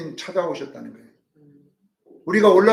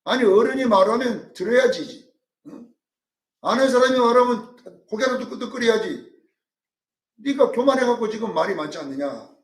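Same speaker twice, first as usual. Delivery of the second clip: higher, louder mock scolding; scolding elder; 5 semitones higher, 4 dB louder than usual. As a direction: talk down to Job like an annoyed elder. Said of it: "말 많다고 그래".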